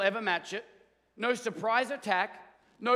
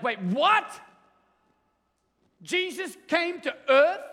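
high, loud, stunned disbelief; a thrilled alien who can't believe it; pumped alien; 7 semitones higher, 6 dB louder, slower than usual. Retold or imitated. Alien hears Jesus came to Earth, thrilled and loud. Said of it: "the alien is just pumped".

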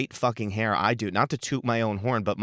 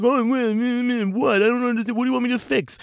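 much higher, baffled and defensive barber voice; flustered barber; 12 semitones higher, 3 dB louder than usual. Retold or imitated. Barber answering the furious customer, high and flustered. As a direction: squeak out the barber's helpless confusion. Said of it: "The guy's like".

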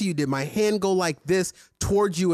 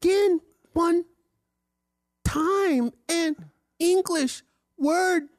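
high, slow mock of a bad reporter; mocking radio delivery; 11 semitones higher, slower than usual. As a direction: stretch each word like a clumsy newsreader. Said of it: "that was just the worst radio delivery".

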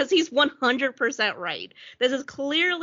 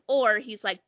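ordinary delivery

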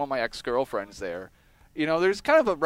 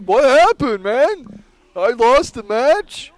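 high, loud, stretched-out whine of disbelief; a wrestler whining after losing the match, high and loud; mock Alex voice; 8 semitones higher, 10 dB louder, much slower than usual. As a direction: play the baffled wrestler who just lost, pitched way up. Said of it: "he's like".

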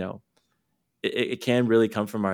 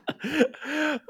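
high burst of laughter; 3 dB quieter than usual. Real, not acted. Laughs at forgetting her point.

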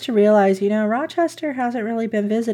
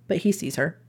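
normal talking voice

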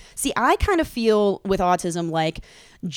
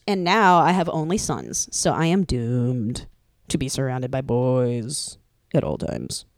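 usual speaking voice

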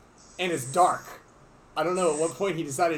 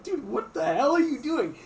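higher, slow, baffled yell at a teammate; incredulous shout; 9 semitones higher, slower than usual. Retold or imitated. Running up to Alex, a stunned, drawn-out yell. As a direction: demand what on earth he is doing. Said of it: "I'm like".

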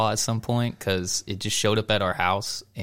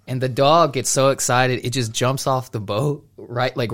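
usual speaking voice

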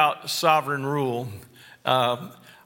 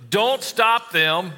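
firm, slow command, pitched up; emphatic; 6 semitones higher, slower than usual. Retold or imitated. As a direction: lay down the law, each word weighted. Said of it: "it was very emphatic in how he said that".

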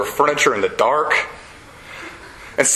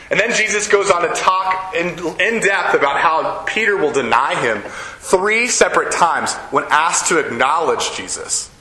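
usual speaking voice

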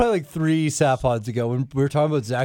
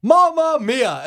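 slow, stretched excited yell; excited shout; 8 semitones higher, 6 dB louder, much slower than usual. Retold or imitated. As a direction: belt the catchphrase out. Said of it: "Mauro Ranallo will be like".

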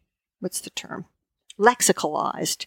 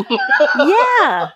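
laughing; 8 semitones higher, 5 dB louder than usual. Real, not acted. Laughs at the phrase.

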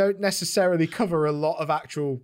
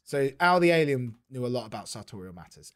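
usual speaking voice

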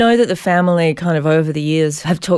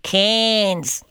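Cairns with a Queensland accent. Shown as a demonstration. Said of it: "I'm just gonna say that for you in a Queensland accent".

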